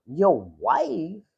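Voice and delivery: ordinary talk, nothing marked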